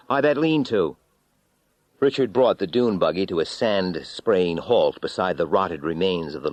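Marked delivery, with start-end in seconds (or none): 0.1-1.0 calling over engine. Acted. Shouting over the roaring buggy.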